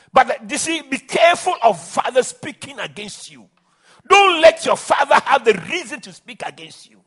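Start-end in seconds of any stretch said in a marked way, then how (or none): none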